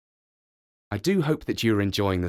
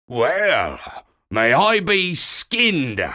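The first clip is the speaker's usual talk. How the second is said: put-on grumpy old walrus; 5 semitones higher, much slower than usual. A narrator's reading. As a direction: grumble it like a cranky old bull. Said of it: "said Seevich".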